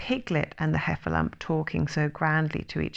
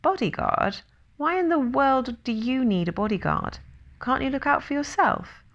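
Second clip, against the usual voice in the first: incredulous questioning; 7 semitones higher than usual. A narrator's reading.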